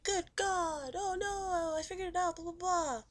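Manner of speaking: high, hammed-up wail; mock horror. Retelling Sonia guessing he is the killer, mock horrified. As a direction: imitate her dismay in a high singsong mockery.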